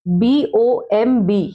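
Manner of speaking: letters spelled one by one, very slow; धीरे-धीरे अक्षर बोलना; slow spelling out. Shown as a demonstration. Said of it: "क्या बना?".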